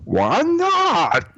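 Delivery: very high, drawn-out tongue-to-one-side voice; squeaky thick-tongued imitation; dumb character attempt. Shown as a demonstration. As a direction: try out the comedian's slurred catchphrase. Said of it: "All right, I'm gonna try it".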